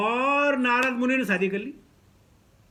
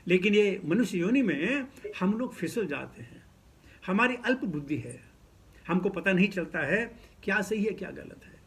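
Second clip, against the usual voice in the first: ordinary talk, nothing marked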